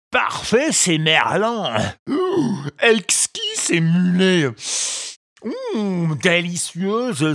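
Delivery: a greedy ogre delighting in his catch; jubilant fisherman. A narrator's reading. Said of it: "il jubilait". (voix d'ogre jubilant et gourmand)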